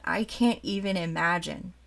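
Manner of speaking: ordinary talk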